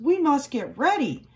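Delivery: high, loud eager call; rally them, loud and high; excited farmer. A narrator's reading. Farmer urging the cows to get ready, eager and loud. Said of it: "he told the cows".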